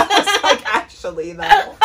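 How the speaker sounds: high laughing words. Real, not acted. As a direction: talk through the laugh.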